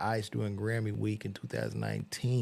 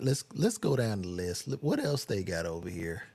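ordinary talk

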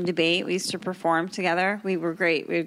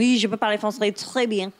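speaking French on request. Shown as a demonstration. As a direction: show off the French with a laugh. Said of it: "You remember how to speak French?".

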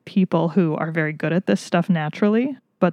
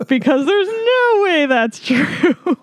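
laughing; 8 semitones higher, slower than usual. Real, not acted. Laughing while telling story.

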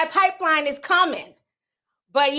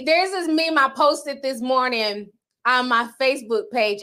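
normal talking voice

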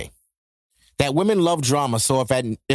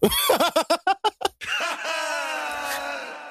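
high shouted burst of laughter; 8 semitones higher, 4 dB quieter than usual. Real, not acted. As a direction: howl with laughter.

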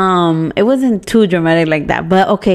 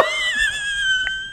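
squealing laugh; high shrieking laugh; 10 dB quieter than usual. Real, not acted. Friend retelling the panic, squealing laugh.

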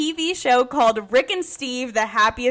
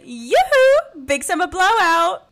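much higher, louder sing-song shopkeeper call; Oaken impression; 6 semitones higher, 5 dB louder, slower than usual. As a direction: belt out a cheery sale pitch. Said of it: "made me think of the Frozen".

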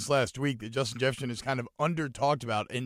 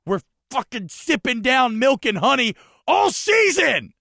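exultant high yell, building louder; triumphant shouting; 12 semitones higher, 11 dB louder than usual. Real, not acted. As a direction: proclaim deliverance at the top of the voice. Triumphant climax of promised land rant.

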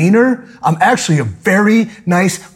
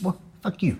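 much softer, muttered insult; quiet mumble; 2 semitones higher, 12 dB quieter than usual. Retold or imitated. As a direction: mutter it under your breath. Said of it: "And I say that".

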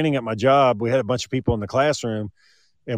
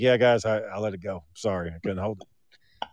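sheepish apology; 5 semitones lower, 7 dB quieter than usual. Retold or imitated. Retold apology for farting.

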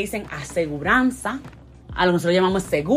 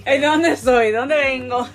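laughing; 4 semitones higher, 3 dB louder, slower than usual. Real, not acted. Mock identity lament.

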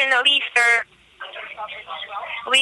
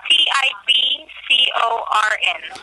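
spelling it out; slower than usual. Real, not acted. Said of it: "How do you spell your last name".

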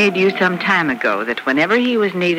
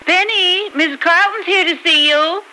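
calling out; 12 semitones higher than usual. Acted. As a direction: call her daughter from downstairs. Mother calls daughter from another room.

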